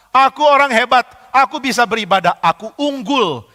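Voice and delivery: loud, high-pitched proud declaration; puffed-up boasting, slowing at the end; mock boastful. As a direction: proclaim it like someone superior. Voicing worshippers who feel superior.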